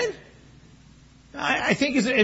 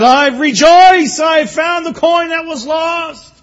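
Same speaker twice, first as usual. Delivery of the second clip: slow, high, loud cry of joy; joyful shout; 10 semitones higher, 9 dB louder, slower than usual. Acted. Woman who found the lost coin shouting her joy. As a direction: shout it out, drawn out with delight. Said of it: "and she said".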